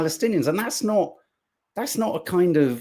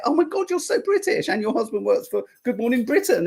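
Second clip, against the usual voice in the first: much higher, squealing mock delight; mock gushing; 8 semitones higher than usual. Host mock-gushing at Yvette Cooper's Britishness, much higher. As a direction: gush like a starstruck fan.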